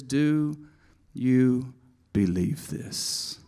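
quiet, slow asking of you believe this; soft question. Shown as a demonstration. Preacher softly reading Jesus' question. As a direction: ask it gently and slowly. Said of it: "He says".

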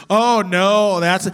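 put-on a loud kid's quick "oh no"; kids objecting. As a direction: blurt it like a child sure of the answer. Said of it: "you're gonna get ninety-five percent of all the kids".

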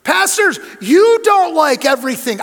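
loud preacher's shout, stressing you; booming pulpit call; full-force preaching. Acted. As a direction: preach it out to the pastors.

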